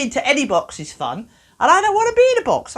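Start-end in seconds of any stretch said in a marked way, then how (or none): 1.6-2.8 much higher, louder and fast; indignant, higher and louder; indignant protest. Acted. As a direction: burst out refusing the box.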